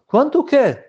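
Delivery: high, playing-dumb groom; feigned innocence. Groom playing dumb when the rabbi demands payment, high and innocent. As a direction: pitch it up as if he has no idea.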